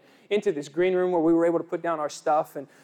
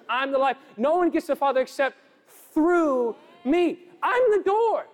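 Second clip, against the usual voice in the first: higher, rising fervent preaching; preaching at full force; 6 semitones higher than usual. Acted. Preacher urges the congregation toward the one door, impassioned. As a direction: build to the altar-call peak.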